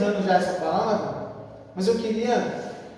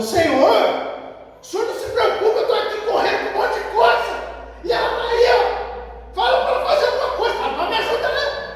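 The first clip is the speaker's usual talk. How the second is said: high-pitched, rapid, agitated complaint; indignant Marta; 11 semitones higher, faster than usual. Acted. Marta complaining to Jesus about her idle sister, indignant. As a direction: play an exasperated tattletale, much higher and faster. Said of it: "E Marta ficou revoltada, falou".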